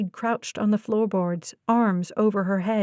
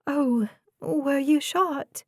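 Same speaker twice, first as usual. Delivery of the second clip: higher, slow, alarmed question; voice pitching high; 4 semitones higher, slower than usual. Acted. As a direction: pitch up despite yourself, slowly. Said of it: "Her voice pitched high against her will".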